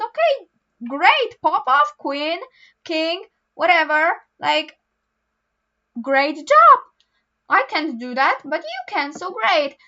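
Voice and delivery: high, punchy bursts of praise; hyped-up cheering. Acted. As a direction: cheer them on with exaggerated enthusiasm. Hyped-up cheering for skilled readers.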